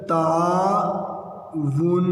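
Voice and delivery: تعاون drawn out very slowly, syllable by syllable; clear pronunciation. Shown as a demonstration. Teacher showing pupils the word's correct pronunciation, clear pronunciation. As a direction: stretch each syllable of تعاون. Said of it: "تلفظ واضح کریں".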